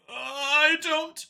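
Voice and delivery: high, strained alien voice; panicked Xeno. A narrator's reading. Cornered Xeno blurting to Vincent, high and panicked. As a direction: squeak it out, cornered and scared.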